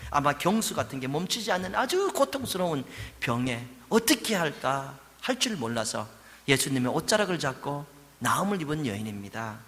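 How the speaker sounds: ordinary delivery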